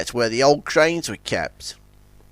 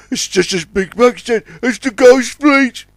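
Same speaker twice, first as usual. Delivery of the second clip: raised, alarmed voice slowing at the end; horrified Warrior; 9 semitones higher, 5 dB louder than usual. A narrator's reading. Warrior realises it is the Ghost Fleet, horrified. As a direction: build to a dreadful realisation.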